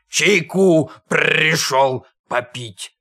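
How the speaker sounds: slow beastly rasp; hoarse, drawn-out wolfish voice; wheezing croak. A narrator's reading. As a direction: croak it out slowly with rolled r. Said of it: "прохрипел ослик".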